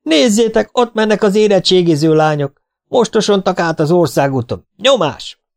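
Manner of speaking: high, loud excited cry; exclaiming. A narrator's reading. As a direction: yell it to send everyone running. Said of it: "felkiáltott".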